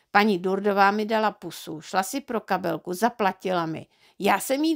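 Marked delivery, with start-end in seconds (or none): none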